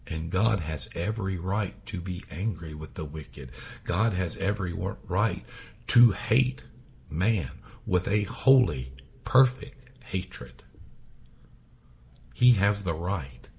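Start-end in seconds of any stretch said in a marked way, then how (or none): none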